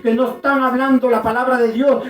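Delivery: loud, driving revival preacher; preaching at full force. Real, not acted. (prédica enérgica a toda voz)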